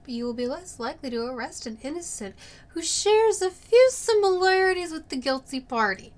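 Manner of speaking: ordinary talk, nothing marked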